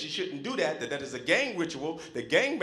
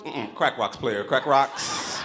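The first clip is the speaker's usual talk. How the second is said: slow, low hustler drawl with a laugh; mock streetwise player voice; street hustler; 5 semitones lower, 5 dB louder, much slower than usual. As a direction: sell it like a smooth street dealer. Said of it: "I'd be like".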